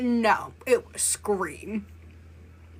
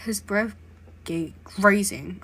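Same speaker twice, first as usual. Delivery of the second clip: usual speaking voice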